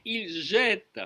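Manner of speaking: normal talking voice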